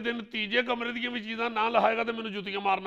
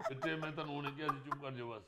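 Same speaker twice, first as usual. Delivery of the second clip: low, very soft telling-off; hushed reprimand; 6 semitones lower, 12 dB quieter, faster than usual. Acted. Officer hushing a man who keeps interrupting, very quiet reprimand. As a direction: quietly shut him up, voice sinking away.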